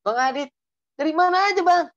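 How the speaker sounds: high, bold Batak call; imitated Batak woman. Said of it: "giliran ketemu orang Batak".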